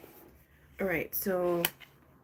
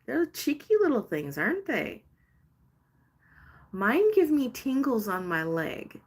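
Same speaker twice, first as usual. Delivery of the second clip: delighted gush; 6 semitones higher, 4 dB louder than usual. Real, not acted. Delighted gush about spirit pokes.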